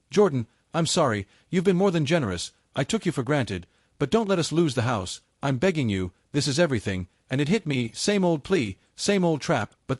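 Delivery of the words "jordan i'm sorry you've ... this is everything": ordinary delivery, nothing marked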